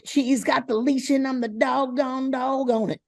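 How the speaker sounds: country twang. Shown as a demonstration. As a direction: sing "doggone" as "dog on" with a country twang. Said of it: "you know, country, you would go".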